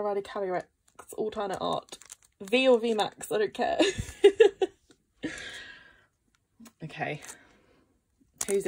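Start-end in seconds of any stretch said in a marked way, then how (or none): 3.8-4.7 loud, high burst of giggles; giddy laugh. Real, not acted. Vlogger before the last pack, giddy laugh. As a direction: laugh it off nervously and loudly. Laughs after saying any card will do.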